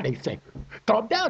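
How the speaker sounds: usual speaking voice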